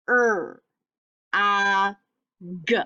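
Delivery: each sound said apart with pauses. Shown as a demonstration. Teacher modeling sounds for spelling. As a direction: segment the sounds slowly. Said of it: "The sounds that we hear".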